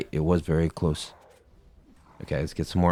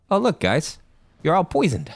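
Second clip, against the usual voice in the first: taunting; 10 semitones higher than usual. Acted. Taunting enemies in game.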